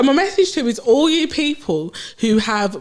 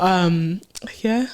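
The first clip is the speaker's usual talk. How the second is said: ordinary talk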